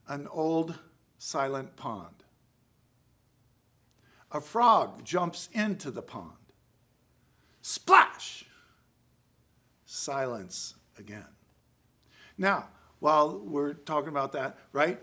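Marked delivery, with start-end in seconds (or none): 0.1-6.5 poem recitation. Shown as a demonstration. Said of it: "kinda goes like this". 7.6-8.4 sudden loud high splash; splash sound effect. Shown as a demonstration. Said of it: "kinda goes like this". 9.9-11.3 poem recitation. Shown as a demonstration. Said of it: "kinda goes like this".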